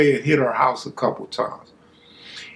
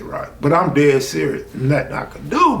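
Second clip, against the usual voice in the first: normal talking voice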